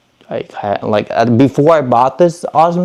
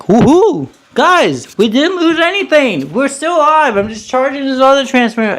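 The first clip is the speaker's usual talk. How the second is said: excited; 12 semitones higher than usual. Real, not acted. Relief that nothing was lost.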